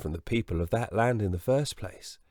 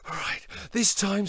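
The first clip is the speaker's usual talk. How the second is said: Jack determined; 10 semitones higher than usual. Acted. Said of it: "said Jack".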